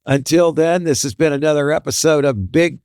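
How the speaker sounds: high, slow announcer voice stretching the show name; host closes the episode to listeners, drawn-out announcer; podcast sign-off. Acted.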